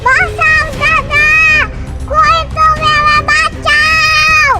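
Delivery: high, slow desperate plea; child crying for help. Acted. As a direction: beg to be saved, drawn out.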